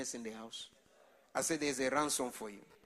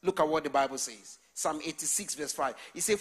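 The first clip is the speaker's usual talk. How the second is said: ordinary talk, nothing marked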